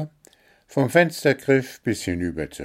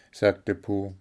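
plain reading voice

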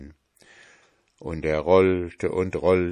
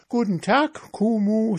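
high, boastful pancake voice; cheeky pancake; 4 dB louder than usual. A narrator's reading. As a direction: taunt them from high up. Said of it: "sagte der Pfannkuchen".

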